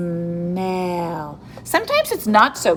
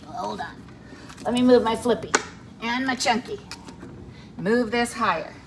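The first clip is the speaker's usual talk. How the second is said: ordinary talk, nothing marked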